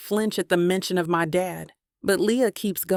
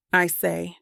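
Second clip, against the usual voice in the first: usual speaking voice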